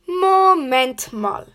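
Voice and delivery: drawn-out and knowing; slow, suspicious. Acted. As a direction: hold her back slowly. Bob stops the suspect.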